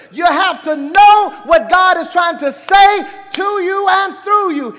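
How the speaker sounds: high, loud pulpit exhortation; full-force preaching. Real, not acted. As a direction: drive each point home to the congregation.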